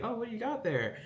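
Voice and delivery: a hint of a playful big brother asking; brother's voice. Brother voiced in retold scene.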